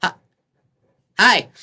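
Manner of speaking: high, loud little gnome. Acted. Gnome answers someone yelling from a window, high loud voice. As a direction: pipe up like a startled gnome. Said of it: "the gnome looks at you and goes".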